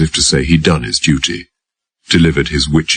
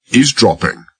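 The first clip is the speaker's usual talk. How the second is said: "Eavesdropping?" thrown back high in outrage; indignant echo; 7 semitones higher than usual. A narrator's reading. Old driver accused of eavesdropping, echoes it indignant and high. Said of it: "Henry's tone and expression reflected indignation or incredulity".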